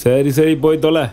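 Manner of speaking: irritated, giving up on him; exasperated dismissal. Acted. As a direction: send your friend off in a huff. Friend refuses drink, dismissed crossly.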